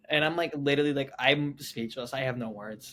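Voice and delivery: ordinary talk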